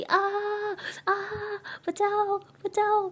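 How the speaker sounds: hammed-up high yelps for help; mock cries for help. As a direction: wail for rescue, all pretend. Meera fakes being beaten. (झूठी बचाओ बचाओ की चीख)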